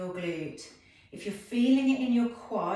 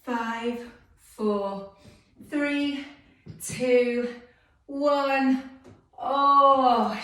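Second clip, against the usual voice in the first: counting down through the burn, louder; straining count; 5 semitones higher, 9 dB louder, much slower than usual. Real, not acted. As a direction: push the last numbers out, higher and louder.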